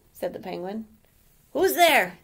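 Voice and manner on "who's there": startled awake. Acted.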